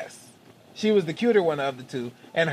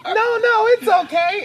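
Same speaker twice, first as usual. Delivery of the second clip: high excited protest; 5 dB louder, slower than usual. Retold or imitated. Retold woman protesting loudly.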